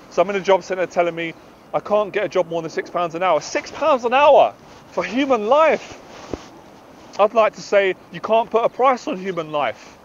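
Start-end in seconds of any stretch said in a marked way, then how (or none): 3.4-6.0 slow, high-pitched incredulous outrage; indignant. Real, not acted. Jobseeker appalled at the wage offered, slow and indignant. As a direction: stretch it out in indignant disbelief.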